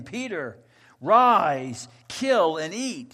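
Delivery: booming command, slow on the last words; divine command. Acted. Voice from heaven commanding Peter, loud and slow. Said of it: "there came a voice to him".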